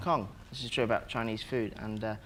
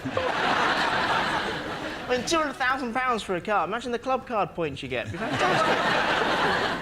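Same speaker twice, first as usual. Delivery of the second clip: laughing; 8 semitones higher, slower than usual. Real, not acted. Laughing through joke.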